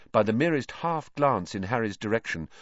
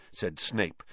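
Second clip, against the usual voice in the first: ordinary delivery, nothing marked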